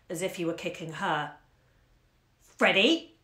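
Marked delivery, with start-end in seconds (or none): 2.4-3.2 cowing sharp call. Acted. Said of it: "in a voice meant to cow him".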